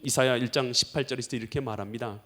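ordinary talk

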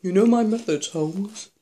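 chewing while talking; taking bites between words; mouth full of snack. Real, not acted. Seen 0: Left speaker bites bar at 0.7, chews mouth-open; both eating throughout.

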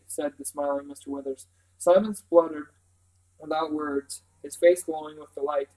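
ordinary delivery

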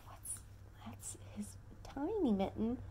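soft whisper over the picture. Acted. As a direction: point and whisper. Reader whispers to child.

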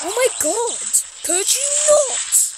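high, slow, drawn-out whine; exasperated plea. Real, not acted. Streamer begging the game to stop, whining. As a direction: stretch it out, pleading with the game.

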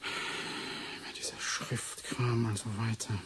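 slow, whispered grumble to himself; whispered aside. Acted. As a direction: whisper it slowly to yourself. Genervtes Selbstgespräch über Papierkram. (langsam vor sich hin geflüstert)

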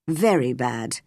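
low, grave man's answer; serious. A narrator's reading. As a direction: confirm it gravely, pitched well down. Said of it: "he answered seriously".

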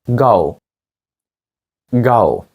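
normal talking voice

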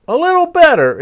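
high, loud call back; called over shoulder. A narrator's reading. Fox calling back to the poet, high and loud. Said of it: "it called over his shoulder".

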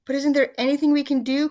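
high, loud pleading question; desperate cry. A narrator's reading. Missus Twit begging her husband for a cure, crying out. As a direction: plead loudly in storybook panic. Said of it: "cried Missus Twit".

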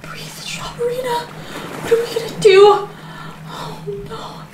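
high, hushed, drawn-out fretting; panicked whimper. Acted. Mother to Strawberryna, hushed high panic. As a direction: fret softly to a comfort toy. Whispers to comfort toy in panic.

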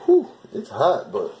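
hot and blowing out air; fanning oneself. Real, not acted. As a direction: puff out a high whew. Feeling the heat from the flame.

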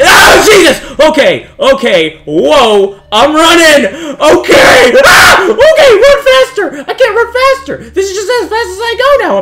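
high, very loud panicked yelling; panicked screaming. Real, not acted. Player fleeing a chaser in a game, panicked screaming. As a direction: scream as if chased and running for your life. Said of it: "wasting energy screaming".

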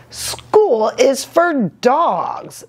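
slow, emphatic mother voice; emphatic mama. A narrator's reading. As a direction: drag out each word like a firm mom. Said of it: "stated Mama June Bugs Jones emphatically".

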